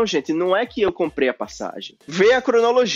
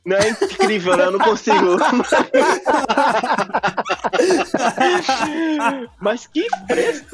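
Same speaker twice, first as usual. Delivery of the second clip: talking through real laughter; helpless laughing; 3 semitones higher than usual. Real, not acted. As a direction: lose it laughing, words squeezed out high. Both break up over his impression.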